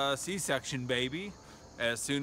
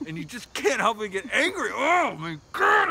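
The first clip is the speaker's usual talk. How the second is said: loud, high, exasperated cry; angry outburst; 8 semitones higher, 7 dB louder than usual. Real, not acted. Admitting how angry work makes him, loud outburst. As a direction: let the rage burst out. Ranting about getting angry.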